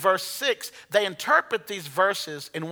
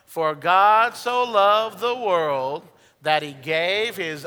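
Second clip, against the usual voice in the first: very slow, drawn-out congregational recital; slow unison scripture reading; slow group recitation; 2 semitones lower, much slower than usual. Shown as a demonstration. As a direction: read it aloud slowly with the crowd. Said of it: "Let's say it together".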